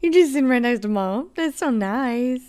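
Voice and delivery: high, cooing voice for the dog; pet voice. Real, not acted. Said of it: "now everyone's heard my pet voice".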